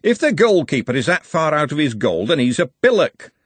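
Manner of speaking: high, loud, chipping-in voice; scornful football bloke. A narrator's reading. Another bloke judging the goalkeeper, scornful. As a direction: butt in loudly with a verdict.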